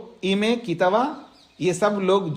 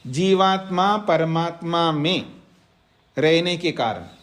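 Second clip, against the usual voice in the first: dictating to students. Shown as a demonstration. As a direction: read it out phrase by phrase so they can write. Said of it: "तो सूत्र का अर्थ लिखिएगा". (छात्रों को धीरे-धीरे लिखवाना)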